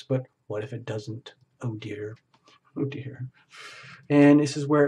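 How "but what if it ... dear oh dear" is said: soft, sinking to a near whisper; hushed worried aside. Acted. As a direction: act out quiet doubt, trailing into a whisper. Voicing a worried inner thought.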